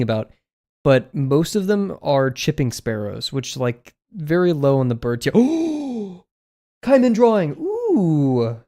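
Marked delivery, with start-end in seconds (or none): 5.3-8.6 real delight, high and loud; squealing with real delight; delighted gasp. Real, not acted. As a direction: gasp and cheer at the drawing. Spots a fan drawing, delighted.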